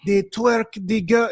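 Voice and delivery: exaggerated African accent, high and slow; mock African accent impression. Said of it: "horrible imitation of an African accent".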